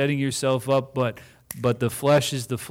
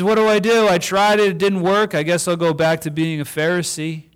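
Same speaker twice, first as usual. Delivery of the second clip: high, loud mock whine of giving up; whiny quitter; 5 semitones higher, 5 dB louder, faster than usual. Pastor mocking a believer who gives up, whiny. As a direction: play the frustrated quitter, hammed up. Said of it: "So he says".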